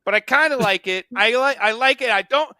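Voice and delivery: lightly waffling comic voice; comedian's hesitant waffle, mildly voiced; Brian Regan impression. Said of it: "starting to sound like Brian Regan".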